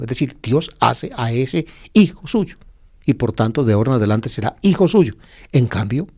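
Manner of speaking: usual speaking voice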